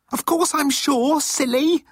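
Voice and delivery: high squealing fangirl; giddy high fan shriek; teenage fangirl voice. A narrator's reading. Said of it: "sounding like a teenage fangirl".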